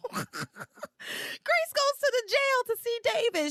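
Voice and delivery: high giggly voice breaking into laughter; laughing. Real, not acted. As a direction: laugh through the words, very high. Mocking dumb Grace, giggling.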